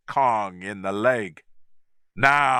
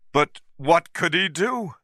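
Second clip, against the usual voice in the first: ordinary delivery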